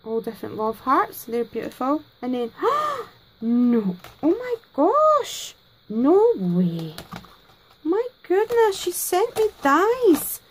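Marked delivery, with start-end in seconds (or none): 2.6-3.0 sudden high gasp of surprise; a crafter gasps opening a friend's parcel; sharp gasp. Real, not acted. 3.4-10.4 high, slow, stunned delight; can't believe what she's holding; unboxing happy mail from a friend, stunned and delighted; overwhelmed surprise. Real, not acted.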